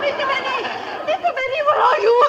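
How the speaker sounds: high, panicked calls; frightened calling. Acted. Rita calling for Mister Benny in fright, high. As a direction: call out in terror.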